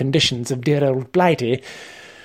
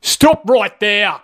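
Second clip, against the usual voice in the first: slow, high-pitched sudden order; thundering command; 6 semitones higher, 4 dB louder, much slower than usual. Acted. Trainer halting the learner from behind, thundering. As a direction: halt him with a drawn-out thunder. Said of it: "Wayne suddenly thundered behind me".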